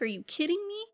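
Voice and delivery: high incredulous disbelief; mock disbelief. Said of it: "And Crow's like".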